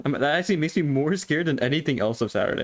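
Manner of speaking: usual speaking voice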